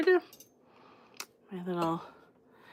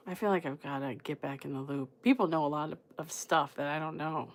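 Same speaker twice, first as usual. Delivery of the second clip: low, very soft aside; musing to herself; 5 semitones lower, 9 dB quieter than usual. Real, not acted. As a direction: think aloud, voice dropped low. Musing on being out of loop.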